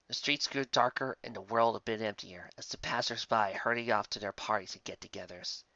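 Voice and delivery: ordinary delivery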